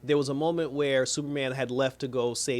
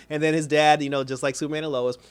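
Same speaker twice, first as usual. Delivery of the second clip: normal talking voice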